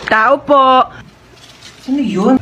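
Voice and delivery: ordinary delivery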